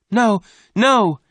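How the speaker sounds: very high, loud pleading cry; desperate cry. A narrator's reading. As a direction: scream it to stop him. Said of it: "Jane cried".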